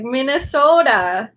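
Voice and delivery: drawn-out imitation Minnesota accent. Shown as a demonstration. Streamer imitating a viewer's accent, mock Minnesota accent. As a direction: say it slowly in a put-on Minnesota accent. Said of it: "I can picture your accent in my head".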